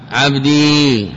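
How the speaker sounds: عبدي said slowly with the long final ي drawn out; stretched ending. Shown as a demonstration. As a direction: draw out the ي in عبدي. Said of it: "كعبدي".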